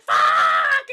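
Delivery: loud, high drawn-out scream; imitated frustrated yell. Shooter cursing after killing him, mock scream. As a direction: let the curse rip, hammed up.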